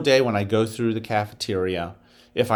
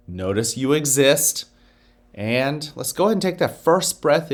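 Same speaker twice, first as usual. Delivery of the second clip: guided meditation; 4 semitones lower than usual. Acted. Principal guiding students through a breathing exercise, calm meditation voice. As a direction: ease the kids into calm, one breath at a time.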